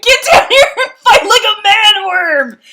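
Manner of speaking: high, loud shout through laughter; laughing shout. Pretend. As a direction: scream up at the worm, half laughing. Imagined rider screaming at worm.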